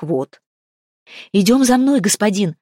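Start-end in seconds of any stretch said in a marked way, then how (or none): 1.3-2.6 loud, out of breath call; breathless shout. Acted. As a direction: shout it while gasping for air. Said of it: "задыхаясь, крикнула она".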